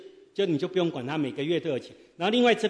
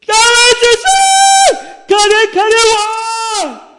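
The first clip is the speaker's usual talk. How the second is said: howling wolf cry; 17 dB louder, much slower than usual. Shown as a demonstration. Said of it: "好像狼叫的声音".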